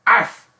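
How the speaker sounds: a quick, high, loud "arf" like a little dog; playful dog bark. Pretend. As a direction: bark once for the pet. Dog picture, pet of the day.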